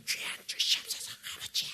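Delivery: voiceless, straining plea to God; fervent mock prayer. Believer begging the Father to give, fervent whispered prayer. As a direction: imitate someone praying hard under the breath. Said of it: "기도만 열심히 해".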